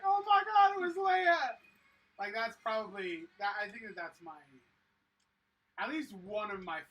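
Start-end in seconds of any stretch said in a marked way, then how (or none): 0.0-1.4 high, loud gasp of recognition; shocked realization. Retold or imitated. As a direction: shout it like you just spotted her under the helmet. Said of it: "and you're like".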